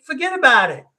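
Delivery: loud, high Italian American "forget about it"; Italian American accent, a hearty shrug-off. Pretend. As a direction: brush it off like a New York Italian American. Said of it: "I'm Italian American. I'm gonna say".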